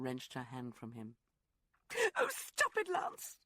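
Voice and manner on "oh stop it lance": much higher, louder outburst; crying out. Acted. Woman cries out at her lover to stop, high and loud. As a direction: cry out to make him stop. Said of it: "she cried".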